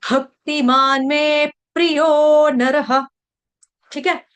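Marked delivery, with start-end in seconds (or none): 0.1-3.1 भक्तिमान में प्रियो नरः chanted very slowly; slow recitation. Shown as a demonstration. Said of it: "भ के ऊपर आघात".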